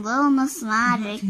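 ordinary delivery

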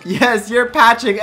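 ordinary talk, nothing marked

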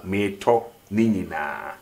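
"Me to ni nyinaa" in spoken Twi, without any R; R dropped. Shown as a demonstration. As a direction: leave out the R in "Me to ni nyinaa". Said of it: "R is somehow eliminated".

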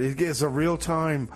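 ordinary delivery

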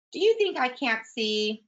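high, disbelieving storybook dog; mock indignant. Another dog doubts Lulu.

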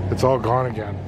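ordinary talk, nothing marked

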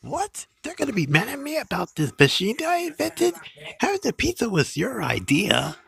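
high-pitched, incredulous protest; squeaky outraged comeback; indignant disbelief. Acted. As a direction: protest the blame, voice leaping up. Indignant at being blamed.

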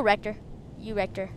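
normal talking voice

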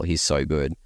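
normal talking voice

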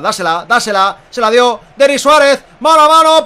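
rising loud urgent call; excited. Real, not acted. Chance building.

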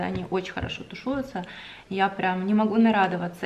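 ordinary delivery

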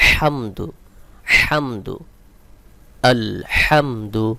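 হামদু with হ pressed from mid-throat; slow, deliberate হামদু, squeezing a strong pharyngeal H; correct throat H. Shown as a demonstration. Teacher models correct letter for learners, pressed throat হ in হামদু. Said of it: "সঠিক উচ্চারণ হবে".

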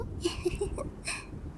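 quiet, bashful titter; embarrassed giggle. Acted. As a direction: giggle softly after an awkward word. Embarrassed after saying mating.

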